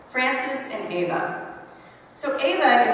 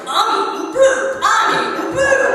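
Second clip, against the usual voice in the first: put-on high, loud childish chant; singsong kid; 11 semitones higher, 6 dB louder than usual. Teacher voicing a boy shouting about his snack. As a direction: taunt it like a giggling little boy. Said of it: "had been walking around going".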